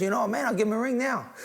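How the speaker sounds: high-pitched eager offer; American bar stranger. Retold or imitated.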